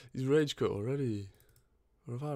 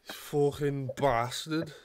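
put-on British accent; 2 semitones higher than usual. Gamer scolds his teammate in a mock British accent. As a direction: sneer it like a grumpy British geezer. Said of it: "United Kingdom accent".

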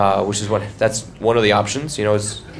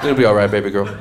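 mock comfort; 4 semitones lower than usual. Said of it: "apply a light tap to your lower back".

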